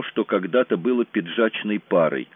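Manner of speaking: ordinary delivery, nothing marked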